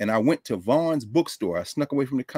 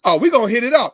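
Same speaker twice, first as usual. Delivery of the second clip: Paul's delighted answer; 11 semitones higher, 8 dB louder, faster than usual. Retold or imitated. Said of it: "He said".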